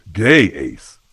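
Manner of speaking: put-on calm Australian accent; easygoing, needling Australian voice; Australian drawl. A narrator's reading. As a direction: greet him in a relaxed Australian drawl. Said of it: "drawled in that infuriatingly calm Australian accent".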